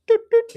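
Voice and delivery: two short, very high, loud doots; high nose-tap doots. Pretend. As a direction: toot out playful little tap noises. Imitated nose-tap in a children's song.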